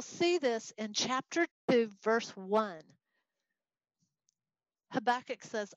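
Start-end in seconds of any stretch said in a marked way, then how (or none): none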